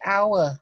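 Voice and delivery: teacher contrasting house and hour; silent h. Shown as a demonstration. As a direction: say hour with no h at all. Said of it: "trong chữ giờ đó thì chữ hạt không đọc".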